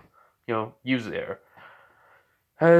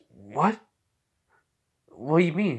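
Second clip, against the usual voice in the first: mild disbelief, pitch jumping up; puzzled, mildly taken aback; surprised questioning; 4 semitones higher than usual. Acted. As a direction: react with a startled question. Acted startled reply in story.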